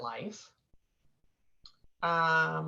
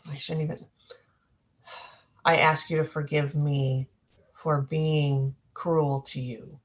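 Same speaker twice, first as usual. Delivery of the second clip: solemn healing apology; 4 semitones lower than usual. Acted. Apologizing to past-life woman.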